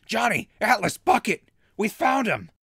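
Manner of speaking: loud, high calling shout; calling the others to say he's found. Acted. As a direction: call out to the camp. Calling out to group.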